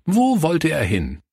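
feigned forgetfulness. Acted. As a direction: play forgetful to draw the children in. Pastor playfully claims he forgot.

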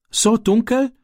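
high, frightened stammer; a boy's startled, fearful question. A narrator's reading. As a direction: gasp it out scared. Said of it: "stammelte Ram".

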